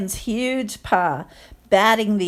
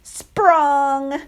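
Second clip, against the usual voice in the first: bouncy sound effect; 7 semitones higher, 4 dB louder than usual. Acted. Sound effect read aloud.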